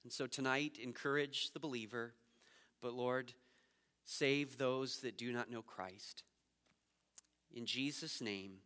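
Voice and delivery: soft, low closing prayer; hushed pastoral prayer. Acted. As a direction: pray it quietly over the room.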